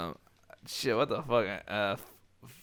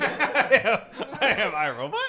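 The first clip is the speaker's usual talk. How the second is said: laughing; 6 semitones higher than usual. Pretend. Friends mock robot speech.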